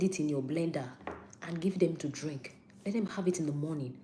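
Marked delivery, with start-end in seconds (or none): none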